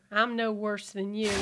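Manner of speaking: ordinary talk, nothing marked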